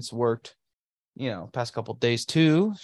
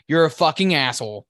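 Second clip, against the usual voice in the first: playful mock outburst, louder; mock angry; 4 semitones higher, 6 dB louder than usual. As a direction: jab at him in mock fury. Teasing insult at co-host.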